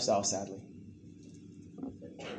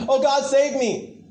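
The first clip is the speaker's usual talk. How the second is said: mock desperate plea; 8 semitones higher, 4 dB louder than usual. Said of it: "calling out to God like".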